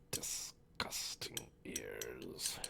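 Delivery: drawn-out breathy whisper; slow whisper. Acted. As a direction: stretch it slowly in a whisper.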